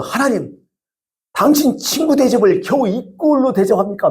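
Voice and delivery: high-pitched, indignant complaint to God; mock a nun scolding God; retold protest to God. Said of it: "하면서 따졌어요". (하나님께 따지는 흉내)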